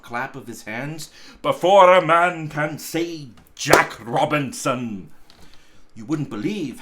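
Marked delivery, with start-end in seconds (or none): 1.4-5.1 much louder, brisk and jolly; hearty loud urging. A narrator's reading. Fezziwig hurrying the lads with the shutters, loud jolly urging. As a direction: hurry them along with a cheer.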